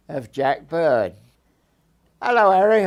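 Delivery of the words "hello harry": put-on a higher, louder greeting in a girl's voice; girl's bright greeting. Elsie greets Harry outside her dad's bakery, bright and raised. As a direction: call out hello to him cheerily.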